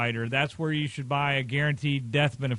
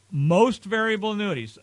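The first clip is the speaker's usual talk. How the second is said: "Most" punched higher and louder, falling after; stress on most; 4 semitones higher, 3 dB louder than usual. Real, not acted. Adviser warns about annuity riders, "Most" stressed. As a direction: hit "Most" hard, the rest lighter. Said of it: "I emphasize the word most".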